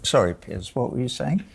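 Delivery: ordinary talk, nothing marked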